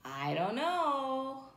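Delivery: usual speaking voice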